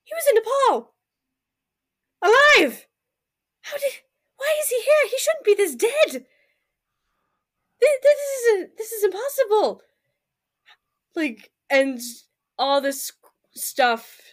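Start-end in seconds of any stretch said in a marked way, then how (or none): 0.0-9.8 high, near-crying panicked rambling; Ella in shocked near tears over a friend's body; frantic disbelief. Acted. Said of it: "she just starts talking aloud".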